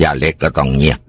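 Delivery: ordinary delivery, nothing marked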